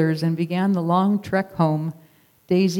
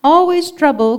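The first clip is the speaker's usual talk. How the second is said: much higher, louder bleating complaint; grumbling sheep bleating together; sheep bleats; 10 semitones higher, 9 dB louder than usual. Acted. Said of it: "came several more bleats".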